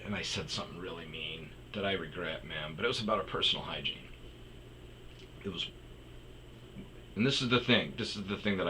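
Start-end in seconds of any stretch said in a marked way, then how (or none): none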